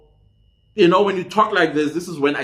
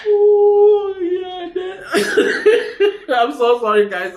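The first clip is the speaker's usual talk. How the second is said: caught off guard laughing; 3 dB louder, much slower than usual. Real, not acted. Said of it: "I got caught off guard".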